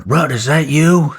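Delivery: high, loud call across the chaos. Retold or imitated. A soldier calling out to a friend under fire, loud and high. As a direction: shout to find your buddy.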